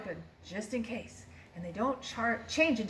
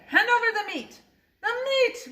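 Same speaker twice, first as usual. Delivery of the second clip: loud, much higher roar; crew roaring; 12 semitones higher, 6 dB louder than usual. A narrator's reading. Crew demanding meat at dinner, roar. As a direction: roar for the meat like hungry pirates. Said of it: "we all roared".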